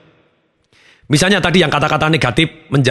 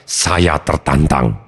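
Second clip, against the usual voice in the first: calm challenged; 9 semitones lower, much slower than usual. Shown as a demonstration. Coach demonstrating the positive reframe, slow and low. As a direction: let each word settle, composed and steady.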